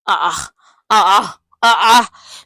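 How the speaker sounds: loud scandalised ah ah ah, dying off; loud mocking ah ah. Real, not acted. Vlogger mocking a housemate's claim, loud scoffing. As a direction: scoff loudly in disbelief. Mock outrage at Faith.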